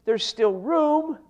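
slow, loud, every word leaned on; emphatic slow quote. Acted. Preacher repeating the servant's report, slow and loud. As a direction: drag it out and let it ring.

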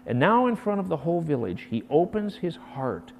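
ordinary talk

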